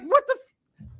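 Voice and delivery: very high, squeaked disbelief; mock shock. Retelling his shock at the insult, high squeaked disbelief. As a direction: let it jump up in shock.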